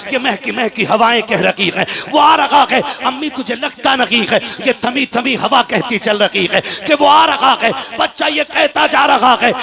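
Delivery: loud, high, climactic sermon delivery; preaching at full force. Acted. Preacher tells the child's dying moment, fervent and loud.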